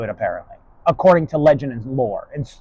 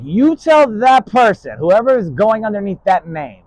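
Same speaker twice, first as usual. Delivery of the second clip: forceful retort; 5 semitones higher, 6 dB louder, slower than usual. Retold or imitated. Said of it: "and I was like".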